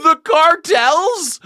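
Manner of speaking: high, slow disbelieving squeal; incredulous. Real, not acted. Streamer reacting to a campaign ad, incredulous squeal. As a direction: repeat it in stunned disbelief. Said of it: "That was so passive aggressive".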